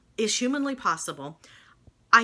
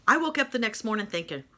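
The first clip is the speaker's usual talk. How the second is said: ordinary talk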